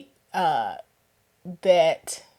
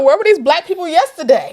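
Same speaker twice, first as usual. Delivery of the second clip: surprised question; 8 semitones higher, 9 dB louder than usual. Retold or imitated. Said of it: "I was like".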